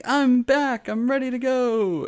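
mock kid voice, way up high; squeaky kid voice. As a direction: pipe it up like a proud little kid. Voicing eager kid character.

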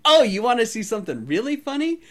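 high, gleeful mock-innocent question; ditzy tease. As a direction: sweetly threaten with a grin. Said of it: "acting super ditzy".

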